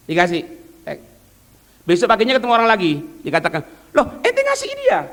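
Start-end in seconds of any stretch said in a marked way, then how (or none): 3.9-5.0 very high, disbelieving question; incredulous friend. Retold or imitated. Friend can't believe he gave money again, very high. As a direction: ask it in sheer disbelief, pitched up.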